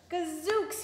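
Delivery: high, hammed-up wolf exclamation; a sly wolf's sudden gasp of delight; wolf exclaiming. A narrator's reading. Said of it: "he whispered".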